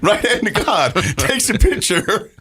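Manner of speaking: high-pitched, insisting through real laughter; laughing. Real, not acted. Swearing to a disbelieving friend, laughing. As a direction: swear it's true while cracking up. Swears it's true while cracking up.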